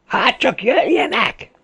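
very high, drawn-out yell; defiant bellow. A narrator's reading. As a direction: dare the enemy to come. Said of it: "ordította Volodya". (magas hangon, dacosan üvöltve)

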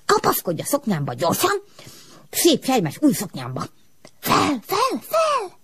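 high, rising to very high fel fel; smoke calling upward. A narrator's reading. The smoke telling Ilus to hold on and go up. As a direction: sweep her upward with the voice.

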